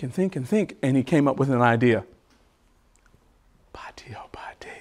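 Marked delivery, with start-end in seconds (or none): 3.7-4.7 hushed, voiceless call to listeners; whispered call. Pretend. Storyteller checks on the children mid-story, whispered call. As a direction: whisper it, inviting a reply.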